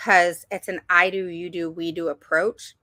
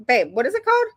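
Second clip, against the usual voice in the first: raised, higher voice to someone nearby; calling out; 6 semitones higher, 5 dB louder, faster than usual. Real, not acted. Mom asks babe for a forgotten book title, calling out. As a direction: call over for a quick answer.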